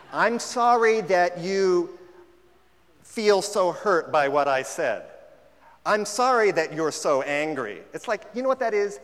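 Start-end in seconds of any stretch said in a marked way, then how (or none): 0.1-7.8 slow, loud mock apology, drawn out; condescending non-apology. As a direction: play the smug fake apologizer.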